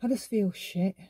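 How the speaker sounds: subdued and plain-spoken; understated sadness. Shown as a demonstration. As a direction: admit it quietly, no display. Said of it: "if you just feel sad".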